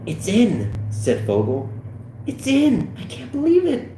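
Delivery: high, squeaky excitement; thrilled, rising to a squeal; amazed disbelief. Acted. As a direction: marvel at it in a high voice. Fogel amazed at his success.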